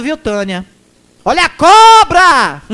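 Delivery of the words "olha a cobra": slow, loud, high singsong "watch out"; mocking warning call. Warning ex-mayor Tânia, mocking.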